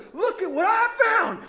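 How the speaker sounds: high, loud excited call of a find; retold yell. Leper yelling to his friend over the loot, high and loud. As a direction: shout it out like a thrilled looter. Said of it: "one of them yells to the other".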